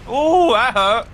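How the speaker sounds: high, loud "ooh" of sympathy; excited yelp. Real, not acted. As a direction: yelp it out as the hit lands. Big hit in the game.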